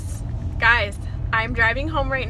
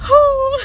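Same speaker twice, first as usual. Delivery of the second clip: shaky exhale; 8 dB louder than usual. Real, not acted. Overwhelmed exhale in car.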